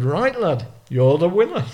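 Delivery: imitated Lancashire accent; strong Lancashire accent. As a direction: declare it as a broad Lancashire steward. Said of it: "in a strong Lancashire accent".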